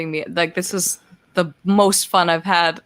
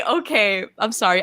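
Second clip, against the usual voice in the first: ordinary talk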